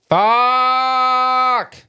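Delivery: anguished shout. Retold or imitated. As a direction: shout it out, gutted by the news. Finding dog crossed Rainbow Bridge.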